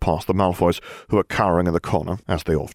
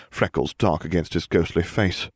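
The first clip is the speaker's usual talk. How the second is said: ordinary delivery, nothing marked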